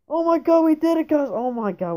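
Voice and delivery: high, very loud celebration; triumphant shouting. Real, not acted. Player celebrating with viewers after finally making it, high excited shout. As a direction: scream it in excited relief.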